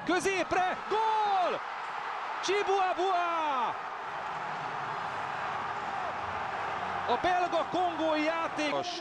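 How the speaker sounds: excited goal call. Real, not acted. Late winning goal called live.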